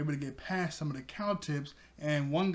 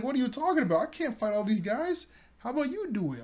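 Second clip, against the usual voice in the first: indignant Nami; 8 semitones higher, faster than usual. Retold or imitated. Nami arguing with Usopp.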